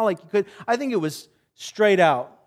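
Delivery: usual speaking voice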